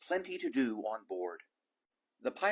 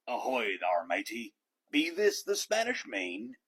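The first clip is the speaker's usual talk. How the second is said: pirate yell; 3 dB louder than usual. A narrator's reading. Head pirate yelling to the boy on the beach. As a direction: bellow it like a pirate captain landing. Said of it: "climbed out of the boat and yelled".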